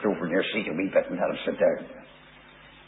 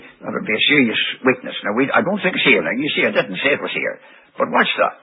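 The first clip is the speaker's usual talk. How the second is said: ordinary delivery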